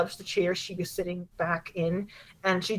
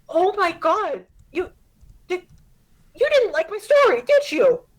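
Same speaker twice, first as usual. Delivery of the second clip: high, louder and faster, stung; a girl suddenly realizing Mom didn't like it; rising upset teen voice; hurt daughter; 11 semitones higher, 4 dB louder than usual. Acted. Daughter realizes mom disliked story.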